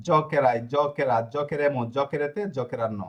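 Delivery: slow, deliberate conjugation drill; measured classroom recitation. Shown as a demonstration. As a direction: spell out each form slowly for students. Teacher reciting conjugation slowly.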